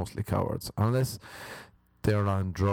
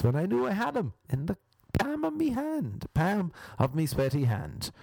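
gloating high voice; 11 semitones higher than usual. Acted. High, fast gloating flourish.

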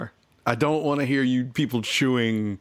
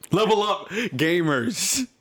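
higher, upbeat host opener; host intro; 6 semitones higher, much slower than usual. Acted. Opening the podcast for listeners, upbeat host intro. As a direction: open the show like a hype host.

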